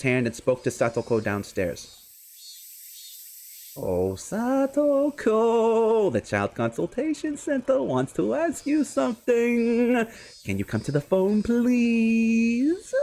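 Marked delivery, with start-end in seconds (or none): 3.8-12.9 higher, very slow syrupy coaxing; sickly sweet voice. Acted. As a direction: sweet-talk her downstairs, menace underneath. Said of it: "in a sweet, bony voice".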